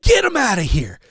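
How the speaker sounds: high-pitched imitation of a yelling friend; hammed-up high yell down the phone; retold frantic yell. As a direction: mimic an excited fight fan hollering. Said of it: "you hear him say this".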